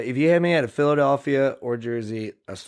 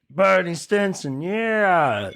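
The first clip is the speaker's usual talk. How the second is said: slow, higher-pitched drawn-out boast; younger self; 5 semitones higher, much slower than usual. Acted. Host voices his twenty-one-year-old self, slow and high. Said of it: "twenty-one-year-old [name] was like".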